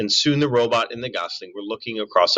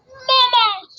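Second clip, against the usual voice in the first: gosling peep; 5 dB louder than usual. Acted. Gosling calls again.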